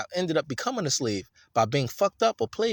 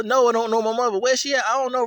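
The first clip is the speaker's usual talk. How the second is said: high, loud, exasperated answers; the motherless man; 8 semitones higher, 4 dB louder than usual. Acted. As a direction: voice him answering, fed up. Acted street conversation.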